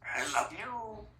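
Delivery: put-on talking to a baby. High, slow, crying sound.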